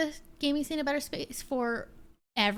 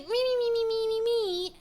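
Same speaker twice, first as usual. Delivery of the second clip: high-pitched mocking "me, me, me"; whiny nonsense imitation; mocking complaint; 6 semitones higher than usual. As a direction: mimic his complaining in a high singsong. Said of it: "started complaining".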